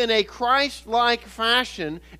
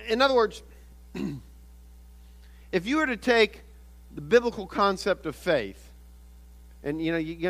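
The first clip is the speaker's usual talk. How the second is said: ordinary talk, nothing marked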